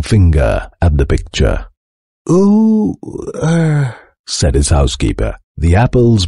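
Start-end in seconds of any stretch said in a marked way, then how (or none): 2.3-4.0 high, drawn-out ooh of wonder; uneasy amazement. A narrator's reading. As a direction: react high, unsure what you're seeing. Housekeeper watches picture change.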